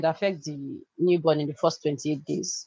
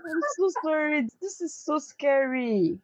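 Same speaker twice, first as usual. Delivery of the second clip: tearful sympathy; 9 semitones higher than usual. Real, not acted. Co-host moved by crisis story.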